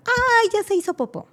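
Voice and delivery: high, chirpy doctor's exclamation; imitating the doctor. Retelling the doctor at the birth, a high chirpy imitation. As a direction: mimic the doctor's cheerful surprise. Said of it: "Es su expresión".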